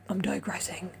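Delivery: soft whispered aside; hushed self-correction. Real, not acted. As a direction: drop to a secret whisper. Aside after digression.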